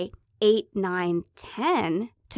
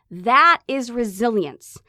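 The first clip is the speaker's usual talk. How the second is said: ordinary delivery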